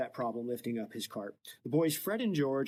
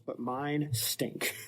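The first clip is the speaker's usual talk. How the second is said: slow; much slower than usual. Retold or imitated. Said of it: "Harry's like".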